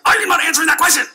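high, fast, loud outburst; agitated shout. Retold or imitated. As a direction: blurt it out in a rattled yell. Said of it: "it came out like".

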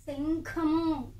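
శంఖము drawn out slowly for the class; slow teacher model of శంఖము; slow model word. Shown as a demonstration. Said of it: "ఏమంటాం?".

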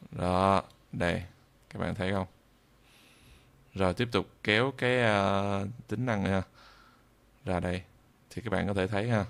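ordinary talk

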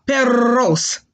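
"Perros" with a trilled double R; Spanish "Perros", tongue-trilled R; rolled R. Shown as a demonstration. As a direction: roll the R in "Perros". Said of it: "roll the R".

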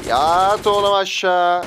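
slow, loud order; commanding shout. Real, not acted. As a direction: drag it out like a command. (yavaş ve yüksek emir)

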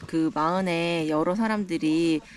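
ordinary talk

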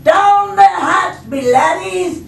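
high, loud, drawn-out holler; pirate captain yelling. Acted. Braidbeard starts the crew's dinner, yelling. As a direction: bellow a rowdy pirate toast. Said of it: "pounded his fist on the tables and yelled".

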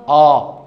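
"اه" said loud and wide open; open ah sound. Shown as a demonstration. Teacher models "اه" loudly for students. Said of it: "فده عليه فتحه كده يبقى".

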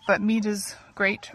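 normal talking voice

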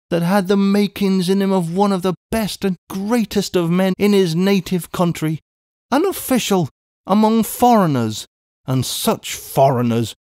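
high, plaintive lament; mourning. A narrator's reading. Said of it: "mourned Miss Pross".

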